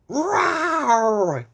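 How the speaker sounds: big high loud roar from a dragon. Acted. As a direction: roar like a scary dream dragon. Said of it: "he growled".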